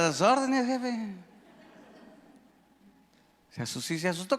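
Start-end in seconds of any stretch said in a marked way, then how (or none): 0.0-1.2 lightly imitated comic underling, pitched higher; a hint of a comic, obliging subordinate; Cantinflas. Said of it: "Como Cantinflas".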